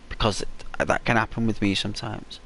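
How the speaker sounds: ordinary talk, nothing marked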